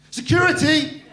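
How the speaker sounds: high, very loud call; mock shout for security. Comedian calls security on an upset woman, mock shouting. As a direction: bellow for help in comic alarm.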